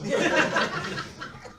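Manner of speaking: high, loud, airy burst of laughter; breathy laugh. Real, not acted. Laughing about his pissed wife.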